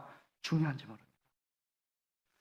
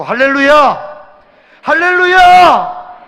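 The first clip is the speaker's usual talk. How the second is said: higher and louder, drawn-out hallelujah; shouted hallelujah; 9 semitones higher, 11 dB louder, much slower than usual. Acted. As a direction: shout it out to rouse the church.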